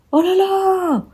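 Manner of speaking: high surprised exclamation. Real, not acted. Vlogger reacting on camera, high surprised cry. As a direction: let it leap up in surprise.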